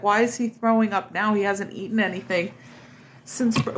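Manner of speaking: normal talking voice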